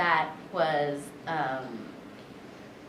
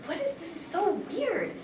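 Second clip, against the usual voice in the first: confused; 12 semitones higher than usual. Retold or imitated. Retold confused reaction.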